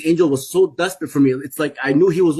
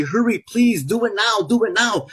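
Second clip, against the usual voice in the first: higher, urgent pleading from the angel; begging angel; 7 semitones higher than usual. Retold or imitated. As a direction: beg him to hurry, a light take on the angel. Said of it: "It was begging me".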